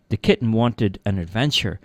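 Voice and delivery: usual speaking voice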